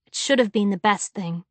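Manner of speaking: ordinary delivery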